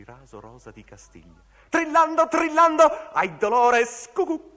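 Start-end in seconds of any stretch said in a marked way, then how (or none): 1.7-4.5 very high, loud theatrical trill; trilling cry. Acted. Trilling climax in the recitation. (grido trillante e acutissimo)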